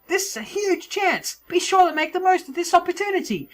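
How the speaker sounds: high, squeaky announcer voice; Toad. Acted. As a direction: proclaim the rules in a high cartoon voice. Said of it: "That's probably a less annoying Toad voice".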